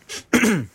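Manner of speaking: a short, high, nervous throat clear. A narrator's reading. Shy Busse clears his throat before speaking on. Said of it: "Er räusperte sich".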